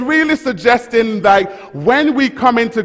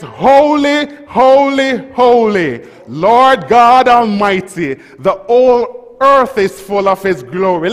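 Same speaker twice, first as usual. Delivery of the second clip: loud, high, ringing holy; preached proclamation; 4 semitones higher, 4 dB louder than usual. Shown as a demonstration. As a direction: cry it out like angels. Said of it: "Say it".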